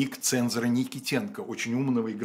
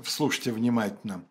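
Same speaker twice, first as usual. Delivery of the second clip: normal talking voice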